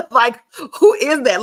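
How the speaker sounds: high, loud mock-confused teasing with a laugh; joking with a tearful fan, high and loud; playful teasing. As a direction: play dumb to make her laugh, pitch up high. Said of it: "I wanted to make her laugh".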